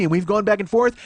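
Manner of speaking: ordinary talk, nothing marked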